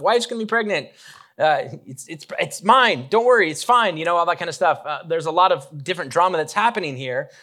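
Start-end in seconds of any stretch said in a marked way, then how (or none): 0.0-4.0 high, loud, rushed panicky reassurance; mock-panicked, higher and louder; flustered rambling. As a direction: babble like a husband caught off guard. Zachariah flustered, unable to explain.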